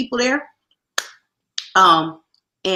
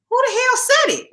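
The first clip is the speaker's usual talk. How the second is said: shocked. Retold or imitated. Retold startled reaction at work.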